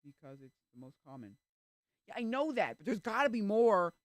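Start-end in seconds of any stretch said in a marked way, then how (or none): 2.1-3.9 high, loud, frustrated retort; exasperated protest. Real, not acted. Argues for other memes.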